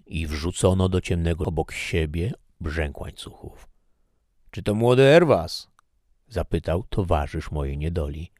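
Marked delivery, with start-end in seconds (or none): 4.5-5.7 raised, louder questioning voice; called-out question. A narrator's reading. Fellow prisoner asks who is in the dark, called-out question. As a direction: call out higher, with force.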